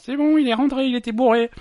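a wife calling to cancel, exasperated; wife calling back. Retold or imitated. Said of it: "Vingt-deux heures trente". (épouse qui rappelle, voix aiguë et forte)

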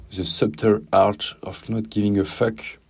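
slow, careful English accent from a French speaker. Shown as a demonstration. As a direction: read the English title with a French-tinged English accent. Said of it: "si tes oreilles ont grésillé avec mon accent anglais". (titre lu avec un accent anglais appuyé)

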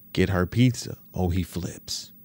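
ordinary delivery

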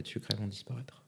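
hushed dramatic whisper. Acted. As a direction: whisper the yeast's demise.

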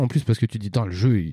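ordinary delivery

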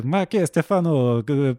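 hammed-up singsong Italian accent. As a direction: hail a colleague like a cartoon Italian. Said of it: "je parlais couramment italien".

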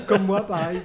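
words squeezed out through a laugh; amused laughing agreement. Real, not acted. Laughing agreement after joke.

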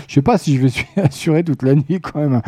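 ordinary talk

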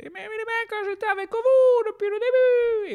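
high put-on pleading voice. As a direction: beg them you were on their side. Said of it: "je leur dirais".

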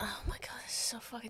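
very quiet, whispered aside; muttered exasperation. Real, not acted. Co-host reacting to a stupid plot, whispered exasperation. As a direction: say it under your breath, fed up. Co-host's aside under the recap.

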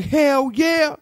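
high, loud yes; excited agreement. Retold or imitated. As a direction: agree with full enthusiasm. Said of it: "She was like".

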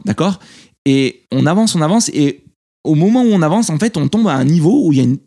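ordinary talk

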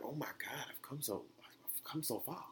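soft, amazed self-quote; hushed awe. Retold or imitated. Podcaster hearing an old episode of himself, hushed awe. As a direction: marvel softly, almost to yourself.

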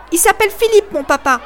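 put-on boy defying the schoolboys; defiant. A narrator's reading. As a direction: throw the words like a stone. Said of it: "comme il l'aurait fait d'une pierre".

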